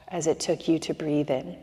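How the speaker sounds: ordinary delivery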